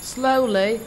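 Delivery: plain reading voice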